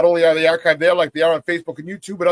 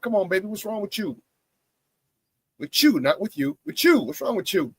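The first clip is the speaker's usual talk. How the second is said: mock scolding; 5 semitones higher than usual. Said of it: "I'm kidding".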